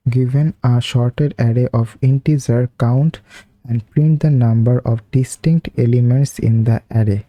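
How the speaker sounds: reading the English task very slowly, word by word; slow read-aloud. Real, not acted. Teacher reading the problem to viewers, very slow and deliberate. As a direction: spell out the problem statement slowly for students reading along.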